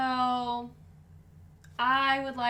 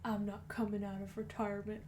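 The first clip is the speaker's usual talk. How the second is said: lightly voiced southern drawl; 2 semitones lower, 8 dB quieter than usual. Cohost riffing as a retired bounty hunter, in a light southern accent. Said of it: "Boba Fett has a southern accent now".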